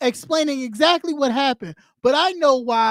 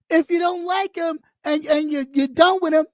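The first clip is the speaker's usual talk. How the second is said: normal talking voice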